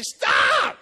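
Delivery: very high, very loud stop; shouted stop. Retold or imitated. Preacher yelling at his partner to stop praying. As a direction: yell it to cut him off. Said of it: "I was about to say".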